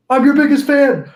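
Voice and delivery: put-on high, loud starstruck kid voice; gushing young fan. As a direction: gush like an overexcited superfan. Retelling fan message as kid.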